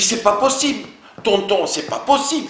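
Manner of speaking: a cry of it can't be; shocked disbelief. Retold or imitated. Meksan hearing of the arrest, shocked and loud. Shocked reply to bad news.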